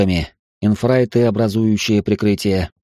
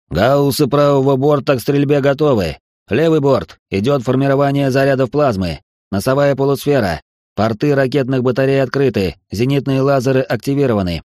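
raised, urgent weapons readiness report; combat callout; 5 semitones higher than usual. Acted. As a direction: call it out like bridge crew. Crew reports weapons ready.

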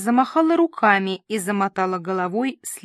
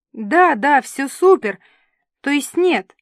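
loud, high hurried reassurance; flustered; 5 semitones higher, 7 dB louder than usual. A narrator's reading. Host answers the scolding assistant, flustered. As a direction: agree eagerly, then back down.

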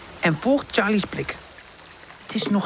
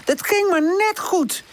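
high-pitched warning call; high, animated character calling down; 11 semitones higher than usual. A narrator's reading. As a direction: call out the danger, drawing words out. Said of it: "roept Woezel naar beneden".

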